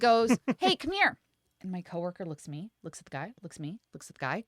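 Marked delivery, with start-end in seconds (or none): 0.6-1.2 high-pitched mock customer beckoning; retold call-over, pitched up; imitated summons. Customer calling over a male coworker.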